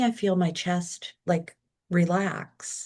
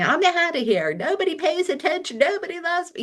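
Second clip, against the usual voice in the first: much higher, whiny mock tantrum; mock self-pity; 11 semitones higher than usual. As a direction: ham up the old sulky storming-off self. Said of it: "mine would have been like".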